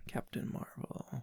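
very soft, slow whisper. Real, not acted. Host echoing the co-host's suggested title, slow whisper. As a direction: breathe it out slowly, barely audible. Echoes co-host's answer under breath.

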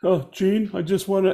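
high pleading fan voice; gushing fan. Retold or imitated. Scary biker gushing to Gene, high and pleading. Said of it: "they're like".

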